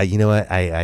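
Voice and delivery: ordinary talk, nothing marked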